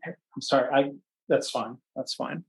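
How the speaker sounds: quiet, off-hand reassurance; hushed aside. Real, not acted. Speaker to someone walking in on the webinar, hushed aside. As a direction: murmur it softly away from the audience.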